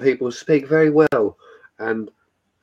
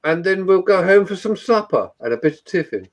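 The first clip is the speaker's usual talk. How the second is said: put-on a lightly higher posh English accent; 4 semitones higher than usual. Mocking posh Brits for friends in posh English accent. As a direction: plan the day like a posh English gent. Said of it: "I'll get the pipe out".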